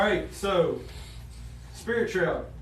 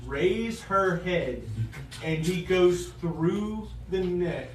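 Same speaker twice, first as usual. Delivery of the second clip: game master's narration; 4 semitones lower, 7 dB quieter than usual. Acted. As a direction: narrate the kill quietly and deliberately.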